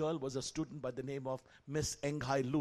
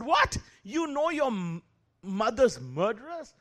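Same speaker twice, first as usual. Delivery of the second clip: high, loud gasping what; shocked disbelief; 7 semitones higher, 7 dB louder, slower than usual. Retold or imitated. Teacher hears his student's mother was murdered, shocked high cry. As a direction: recoil in disbelief at the news.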